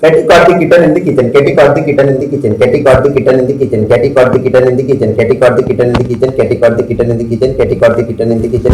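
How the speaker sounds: fast, nonstop repetitions; rapid tongue twister. Shown as a demonstration. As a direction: rattle it off on a loop, sagging slightly. Husband races through repeated tongue twister.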